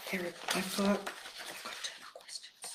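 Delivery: a breathless whisper to herself; whispered aside. Real, not acted. Host whispers to herself over her notes, hushed whisper. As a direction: drop to a whisper, half to herself.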